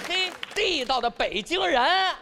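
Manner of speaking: high, slow mock marvelling; amazed exclamation. Father looking at his wrinkled newborn, high mock amazement. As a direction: marvel high, stretching the words. Comic exclamation on seeing baby.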